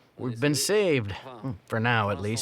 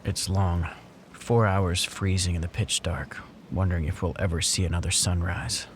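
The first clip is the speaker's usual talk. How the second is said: documentary narration; 5 semitones lower than usual. A narrator's reading.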